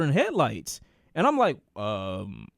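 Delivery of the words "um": low, softened uncertain mumble; awkward hesitation. Retold or imitated. Caught as sisters come upstairs, awkwardly stalling. Acts out being caught, unsure.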